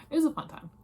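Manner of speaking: "It was a fun time" blurted high and loud, gushing; excited burst. Real, not acted. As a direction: throw out "fun time" with a big excited lift. Says it twice, first burst.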